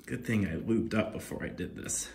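normal talking voice

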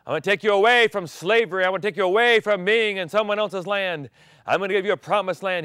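loud, high rallying promise; fervent call. Acted. Preacher voicing God calling Israel out of slavery. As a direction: declare freedom with fire. God's liberation declared loudly.

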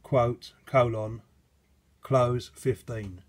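slow, loud, spelled out word by word; dictating. Shown as a demonstration. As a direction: read the command out slowly and clearly. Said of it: "typed in the command".

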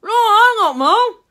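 loud, high words round a mouthful; high child's voice, mouth stuffed; mouth full. A narrator's reading. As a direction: call out while chewing chocolate. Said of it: "said Charlie with his mouth full".

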